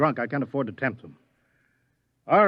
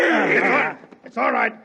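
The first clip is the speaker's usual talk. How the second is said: urgent calming shout; 10 semitones higher, 5 dB louder than usual. Acted. Scientist calls off the struggle.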